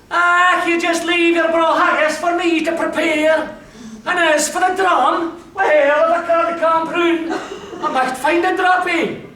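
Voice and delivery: put-on high, hearty old crony calling out with a laugh; jolly Scots crony. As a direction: boom it out like a cheery wee Scotsman offering haggis. Voices crony Willie McNair.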